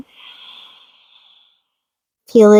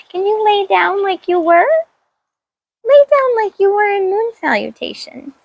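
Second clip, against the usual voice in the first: much higher sing-song talk to a dog; high pet voice; 9 semitones higher than usual. Real, not acted. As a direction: sweetly ask the dog to lie down, pitched up. Asking dog to lie down.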